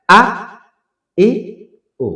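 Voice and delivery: আ, ই, উ each cut short, with pauses between; quick short vowels. Shown as a demonstration. Teacher demonstrating hamza's sounds, each one quick. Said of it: "উচ্চারণটা তাড়াতাড়ি".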